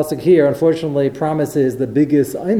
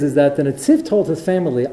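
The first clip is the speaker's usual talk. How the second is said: normal talking voice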